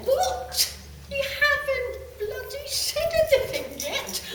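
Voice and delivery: high, loud, laughing outrage at the guides; mock-outraged what; indignant disbelief. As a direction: squeal it in half-laughing disbelief. Indignant retort to spirit guides.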